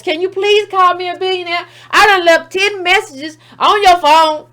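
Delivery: loud, whiny mock begging with fake crying; hammed-up tearful pleading. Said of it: "[name] out here begging Forbes".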